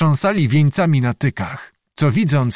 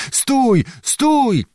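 high-pitched call to halt; 12 semitones higher than usual. A narrator's reading. Voices on both sides halt a wedding party, calling out. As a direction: call it out high, each word falling. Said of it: "wołano z obu stron".

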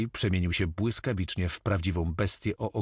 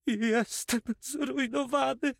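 much higher, slow, whimpering lament; sobbing; slower than usual. A narrator's reading. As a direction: whimper it out like a ruined miser. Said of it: "załkał notariusz". (notariusz łka, piskliwie i powoli)

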